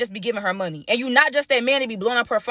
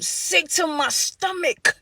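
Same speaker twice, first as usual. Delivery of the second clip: disgusted; 6 semitones higher, 3 dB quieter, much slower than usual. Real, not acted. Disgust at the lie.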